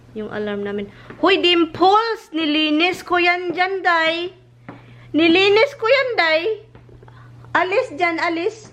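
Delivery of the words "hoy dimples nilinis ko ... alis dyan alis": sharp, higher scolding at the dog, slowly. Real, not acted. Owner scolding Dimples off the freshly cleaned couch, exasperated scold.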